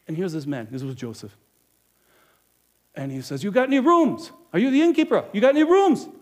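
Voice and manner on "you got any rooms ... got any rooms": high, loud and urgent; desperate traveler begging for a room; frantic. Acted. Joseph begs the innkeeper for a room, frantic and loud. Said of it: "He was sort of frantic, very excited".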